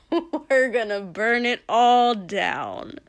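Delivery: loud, drawn-out villain's threat; gloating villain. A narrator's reading. Villain promising destruction to Shoto, loud slow gloating. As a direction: relish the threat, stretching it out slowly.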